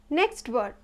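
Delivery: ordinary talk, nothing marked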